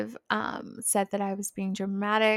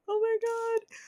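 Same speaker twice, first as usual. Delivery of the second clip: much higher excited gasp; thrilled squeal; 13 semitones higher than usual. Retold or imitated. Relieved patient squealing at seeing her favorite assistant. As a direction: squeak it out with delight.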